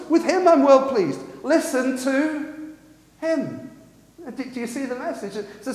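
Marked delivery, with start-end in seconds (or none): 0.1-3.6 "him" punched high and loud; raised, forceful stress on "him"; emphatic. Shown as a demonstration. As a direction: drive home "him", stretching "Listen to" before a pause. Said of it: "Now listen to the emphasis".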